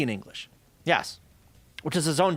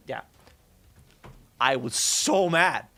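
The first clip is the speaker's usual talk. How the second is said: ordinary talk, nothing marked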